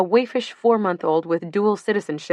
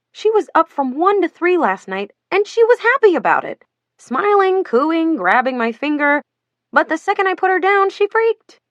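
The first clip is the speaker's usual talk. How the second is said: excited; 9 semitones higher, 4 dB louder than usual. Acted. Stacy venting about sleepless baby.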